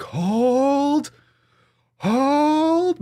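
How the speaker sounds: high stretched battle cry imitation; Braveheart hold call. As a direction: imitate a commander holding the line. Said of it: "he's like".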